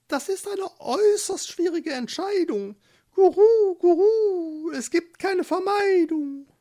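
high, fluttery pigeon chatter; cooing doves. Acted. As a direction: coo the warning like an excited pigeon. The doves comment.

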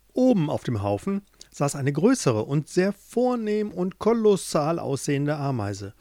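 ordinary delivery

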